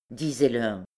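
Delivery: plain reading voice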